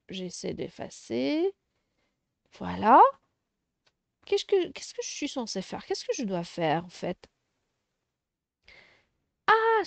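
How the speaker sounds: ordinary talk, nothing marked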